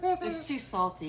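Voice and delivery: high yelp then a wince; salty surprise. Real, not acted. Mother tasting salty brew with her child, surprised. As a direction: react to a mouthful of salt.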